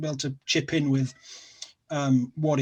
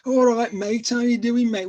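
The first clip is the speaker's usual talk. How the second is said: higher-pitched mock Scouse accent; Liverpool accent; 7 semitones higher, 4 dB louder than usual. Shown as a demonstration. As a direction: put on a chirpy Liverpool greeting accent. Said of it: "but the Liverpool accent".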